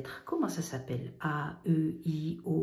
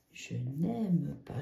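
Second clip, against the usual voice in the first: slow dictation; 3 semitones lower, much slower than usual. Shown as a demonstration. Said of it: "Je vais pas écrire le reste".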